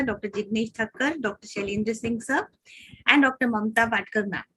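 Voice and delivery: ordinary talk, nothing marked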